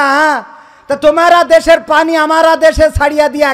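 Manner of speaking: ordinary delivery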